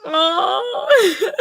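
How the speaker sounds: a high, sobbing cry. Real, not acted. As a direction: let the sob break out on it. Crying at comic ending.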